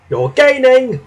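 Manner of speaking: high, loud yell of encouragement. A narrator's reading. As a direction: cheer the engine on at full voice. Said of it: "yelled his driver".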